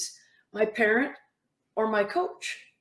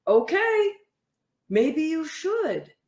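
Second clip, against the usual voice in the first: knowing suggestion; 5 semitones higher, slower than usual. Retold or imitated. Coach's retold reply to athlete.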